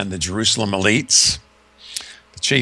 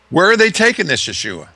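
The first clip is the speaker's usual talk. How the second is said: Barabbas asking urgently; 9 semitones higher, 4 dB louder than usual. Retold or imitated. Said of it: "he asks the jailer".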